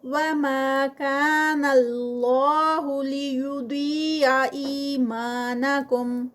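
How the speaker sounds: slow melodic chant with stretched vowels; Quranic recitation. Shown as a demonstration. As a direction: recite the phrase slowly, stretching the madd. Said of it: "ما پہ ٹو counts". (آہستہ مد کے ساتھ تلاوت)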